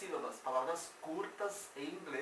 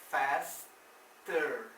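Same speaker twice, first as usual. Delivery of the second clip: "faster" as "fas", long pause, "ter"; slow, separated "faster" for learners; split syllables; 4 dB louder than usual. Shown as a demonstration. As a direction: break "faster" in two with a clear gap. Teacher recaps word in parts.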